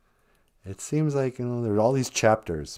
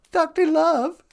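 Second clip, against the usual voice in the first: squeaky, much higher Doctor Love; high silly voice; slower than usual. Pretend. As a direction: pipe it up high and goofy. Repeats name in high voice.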